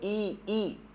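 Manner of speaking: ई said as a long drawn ee sound; teacher sounding out ई slowly, stretched; vowel demo. Shown as a demonstration. Teacher showing students the vowel sound ई. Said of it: "इसका pronunciation है इंक".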